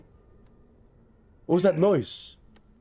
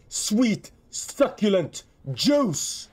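slow, high savoring exclaim; 8 semitones higher, much slower than usual. Real, not acted. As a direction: drawl it like a treat.

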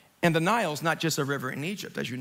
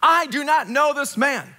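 raised, louder, forceful oath; emphatic denial; 7 semitones higher, 5 dB louder than usual. Acted. Peter denying Jesus to a servant girl, emphatic denial. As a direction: deny it firmly, pitched high. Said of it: "he denied it with an oath".

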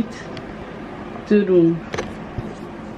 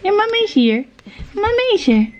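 high tender cooing; 12 semitones higher than usual. Real, not acted. Mother cooing to baby.

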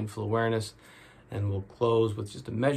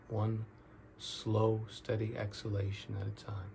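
guided meditation; 7 dB quieter than usual. Real, not acted. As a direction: ease them into each breath, drawing out the key words.

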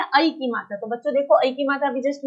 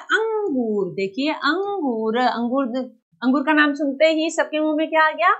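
ordinary talk

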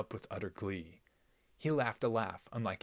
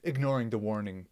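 ordinary delivery

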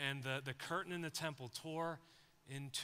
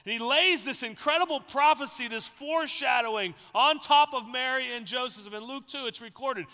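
loud, higher, urgent preaching; preaching at full force; 7 semitones higher, 14 dB louder than usual. Acted. Pastor preaching about Mary to the church, loud and urgent. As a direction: drive it home to the congregation.

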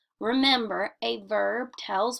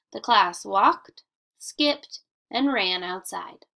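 slow, pausing clearly after walked and skipped; pausing at commas; 5 dB louder, slower than usual. Shown as a demonstration. Teacher reading answer sentence to class, slow with comma pauses. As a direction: model the comma pauses, unhurried. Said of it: "if you listen to me read that sentence".

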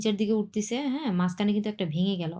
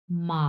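long "মা" dipping then rising; third tone; 3 semitones lower than usual. Shown as a demonstration. Teacher gives an example syllable, "মা" dipping and rising. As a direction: bend "মা" down and up in a V. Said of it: "তিন number tone উচ্চারণ করি".

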